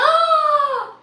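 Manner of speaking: loud, high gasp of surprise; shocked gasp. Real, not acted. Donation from Adam arrives.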